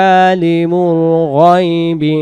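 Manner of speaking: slow, drawn-out melodic recitation; Quran recitation. Acted. Teacher reciting the verse slowly for students to repeat. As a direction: chant each phrase slowly, stretching the vowels.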